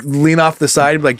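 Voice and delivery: ordinary talk, nothing marked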